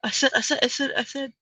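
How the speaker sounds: a quick playful hint of a blustery cartoon rooster; Foghorn Leghorn. Mocking the captions, light cartoon rooster impression. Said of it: "Foghorn Leghorn".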